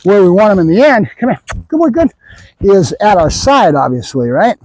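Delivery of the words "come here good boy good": dog praise voice. Real, not acted. Trainer calls dog over.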